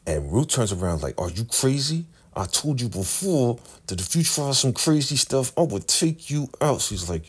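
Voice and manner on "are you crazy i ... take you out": high, sharp mock threat; a hint of menace, scold her. Ruth scolds Bridget for trying again, in a high sharp mock voice.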